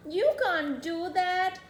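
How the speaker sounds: high, loud mock scolding; screaming mama. A narrator's reading. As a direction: tell off the runaway boy loudly. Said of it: "screams Mama".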